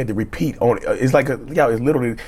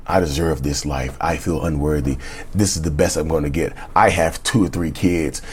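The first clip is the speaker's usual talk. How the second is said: defeated self-talk; 8 semitones lower than usual. Retold or imitated. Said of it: "you have to believe it".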